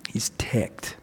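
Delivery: hushed, breathless aside to the congregation; whispered aside. Acted. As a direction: lean in and let it slip like a secret. Describing the angry older brother.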